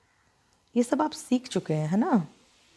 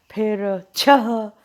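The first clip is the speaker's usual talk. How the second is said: छह spoken very slowly and louder with the final breath; slow careful word; 5 dB louder, much slower than usual. Shown as a demonstration. Teacher spells out छह for the class, very slow. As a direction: lean on छह, slow and clear. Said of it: "example word shown slowly".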